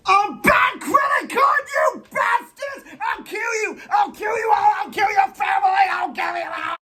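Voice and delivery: high-pitched screaming tirade; peeved, exploding. Acted. Fan raging at whoever ruined his childhood icon, hysterical fury. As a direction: snap into hysterical, shouted threats. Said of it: "I get really peeved".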